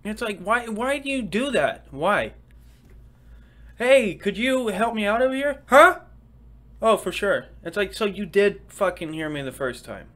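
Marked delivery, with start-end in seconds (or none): 3.8-5.6 high, louder asking voice; mock request. Asking a friend for help, high and bright. 5.7-6.0 very high, loud "Huh?"; mock huh. Friend fakes not hearing, very high and loud. Acted listener reply. 6.8-7.5 mock answer. Acted listener reply.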